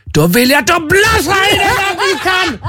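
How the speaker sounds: high, slow screamed command, imitating the nurse; mock-furious German nurse, shrieking far above normal pitch; aggressive shout. German nurse ordering a patient to blow, aggressive shout. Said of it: "hon var så jäkla aggressiv".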